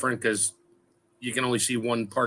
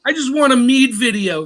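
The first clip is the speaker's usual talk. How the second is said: loud blurt; 11 semitones higher, 8 dB louder than usual. Real, not acted. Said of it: "Sorry, I had to say that".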